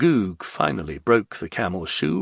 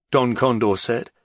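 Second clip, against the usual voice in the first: ordinary delivery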